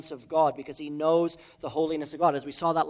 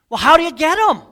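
exclaiming; 13 semitones higher, 14 dB louder, faster than usual. Acted. Rhetorical question to congregation.

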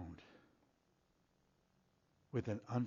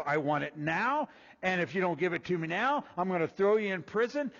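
demanding threat; 6 semitones higher, 8 dB louder than usual. Retold or imitated. Retold harsh demand of slave.

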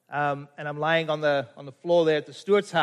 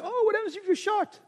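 worried people; 14 semitones higher than usual. Retold or imitated. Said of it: "people say".

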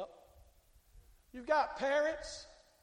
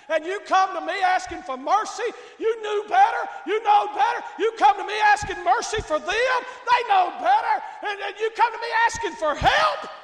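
loud, high scolding rebuke; rebuking shout; 6 semitones higher, 10 dB louder than usual. Acted. Christ rebuking the one who asks for mercy, loud scolding shout. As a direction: scold them like a stern master.

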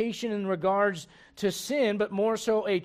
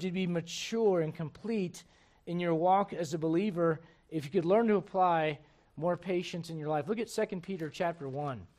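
usual speaking voice

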